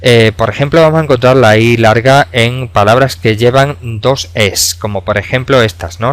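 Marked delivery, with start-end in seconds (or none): none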